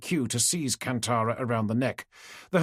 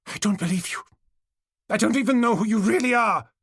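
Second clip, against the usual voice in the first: hysterical accusation; 9 semitones higher, 4 dB louder than usual. A narrator's reading. Hysterical Tesina rejects Kantara.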